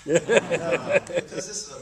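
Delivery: loud, high laughter into words; laughing. Real, not acted. Speaker laughing about making his answer long. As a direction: laugh at yourself, then carry on.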